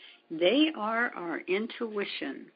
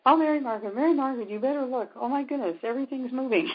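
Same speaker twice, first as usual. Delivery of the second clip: high, urgent re-enacted exclamation; astonished assistant; 7 semitones higher, 3 dB louder, much faster than usual. Assistant calls healer to look at the moving body, amazed. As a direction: imitate an assistant calling out in amazement, pitched high. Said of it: "until my assistant went".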